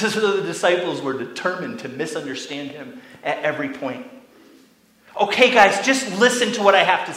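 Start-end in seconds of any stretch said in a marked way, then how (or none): 5.2-7.1 louder, firm call for attention; Jesus voiced as a firm friend calling them to listen. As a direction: gather the bickering disciples. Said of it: "You can almost imagine Jesus saying this".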